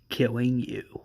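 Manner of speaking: a cold, hushed promise to kill; villain whispering a death threat to Rick; menacing whisper. Acted. Villain ends his threat.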